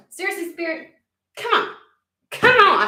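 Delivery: high, loud "come on"; loud frustrated plea; exasperated pleading. Real, not acted. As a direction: beg the spirit to stop joking.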